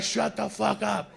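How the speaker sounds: high, slow, stretched-out command; acted exasperated yell. Men answering the woman in back, high slow mock yell. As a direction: drag out the order in mock exasperation.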